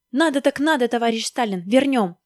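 loud, high, eager compliance; blurted out. Acted. Maskin suddenly gives in to Stalin, blurting. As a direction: burst out agreeing at once. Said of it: "вдруг выпалил он".

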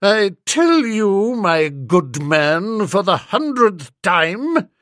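slow, loud grande dame put-down; aunt snubbing the hotel manager, icy and slow; frozen snub. A narrator's reading. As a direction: snub him like a waiter in a restaurant. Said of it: "the voice she usually reserves for snubbing waiters".

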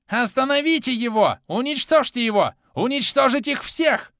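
high, loud hysterical screaming; hysterical yelling. A narrator's reading. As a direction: scream orders in a frenzy. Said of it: "Истерично начал орать Кармадонт".

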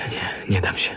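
whispered, almost voiceless no; hushed refusal. Acted. Poet refusing his patroness, whispered defiance. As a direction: refuse her under your breath.

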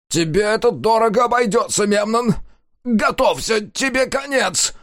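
high, loud shrieking threat. A narrator's reading. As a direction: scream vengeance while fleeing. Fleeing villain threatens.

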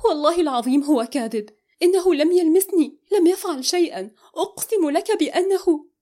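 high, loud, urgent pleading; deeply moved. A narrator's reading. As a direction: swear it desperately, pitch climbing. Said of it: "وقالت فجأة بتأثر شديد".

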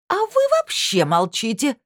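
high piercing screech; shrill screeching bird woman. Acted. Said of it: "пронзительно заверещала она".

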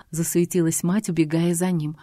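plain reading voice

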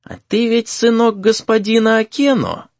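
put-on high-pitched, curious elderly voice; aged voice pitched right up; old man. A narrator's reading. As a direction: question him as a prying old teacher. Old exorcist's unexpected question.

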